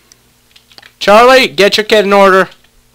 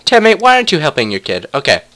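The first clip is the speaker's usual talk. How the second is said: ordinary delivery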